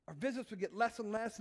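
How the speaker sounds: ordinary delivery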